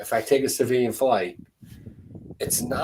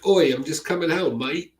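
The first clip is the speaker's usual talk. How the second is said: higher, louder mock Australian accent; put-on Australian; 7 semitones higher, 4 dB louder than usual. Traveller trying out Australian accent at the airport, hammed-up Australian. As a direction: lay on a hammy Australian greeting. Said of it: "I'll try that one in Australia".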